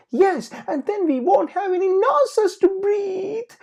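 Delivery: put-on very high, loud child's voice; frightened cry. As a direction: wail it in panic. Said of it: "Polly cried".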